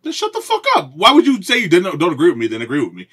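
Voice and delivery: high, loud outburst, then rattled off fast; mock outrage. Real, not acted. Yelling at cohost who agrees after disagreeing. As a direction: explode at him, half joking.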